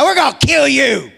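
mock angry crowd, shouted; mock threat shout. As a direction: shout it like a hammed-up mob. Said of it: "and said".

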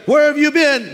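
high, loud insistent demand; mother demanding. Acted. Mother asks her child one last time, loud demand. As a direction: demand an answer as an exasperated mother. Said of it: "I'm gonna ask you one more time".

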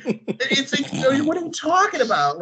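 slow, high, laughing through it; real amused laughter; laughing disbelief. Real, not acted. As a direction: laugh at how silly the question is. Laughing at pasta question.

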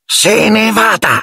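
slow, high, ferocious elf command; Dobby, fierce. A narrator's reading. Elf points a finger at Malfoy, fierce. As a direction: order the enemy away in a fierce squeak. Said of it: "disse in tono feroce".

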